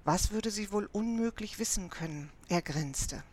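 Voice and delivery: ordinary delivery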